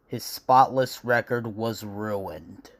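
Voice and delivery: usual speaking voice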